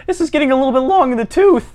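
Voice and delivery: high, put-on prim voice; meek caller. Bullied caller mildly protesting to Chad, high-voiced.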